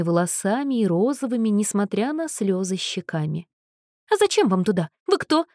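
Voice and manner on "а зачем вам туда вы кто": displeased exclaim. A narrator's reading. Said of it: "недовольно воскликнула она".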